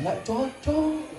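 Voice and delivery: slow, high, soft mother's sing-song; drawn-out mock mama. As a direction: stretch it out like a told-you-so mother. Mother scolding daughter. (mama berkata pelan dan panjang)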